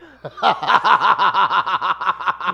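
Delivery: high burst of laughter; loud laugh. Real, not acted. Laughing at camera framing.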